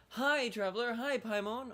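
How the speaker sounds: high, over-friendly mock greeting; chirpy greeting. Player mimicking a character who always greets them, chirpy. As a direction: imitate an overeager hello. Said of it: "it's just always".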